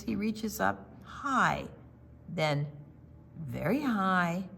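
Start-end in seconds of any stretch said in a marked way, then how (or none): none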